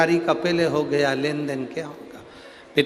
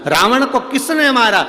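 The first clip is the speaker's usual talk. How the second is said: higher, louder mother asking; mother's question; 9 semitones higher, 6 dB louder than usual. Retold or imitated. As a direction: ask it up high like a mother. Kaushalya questions Ram. (Kaushalya माता ऊँची आवाज़ में पूछती हुई)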